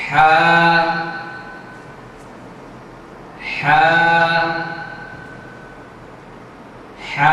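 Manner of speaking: হা said from the middle of the throat, spaced out; letter name Ha. Shown as a demonstration. Teacher models হা for students to copy. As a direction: breathe হা from mid-throat, one at a time. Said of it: "আমি আবারও আপনাদেরকে দেখিয়ে দিচ্ছি".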